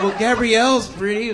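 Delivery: high mock wife voice; the husband mimics his wife suggesting a name, high mock voice.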